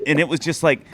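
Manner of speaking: normal talking voice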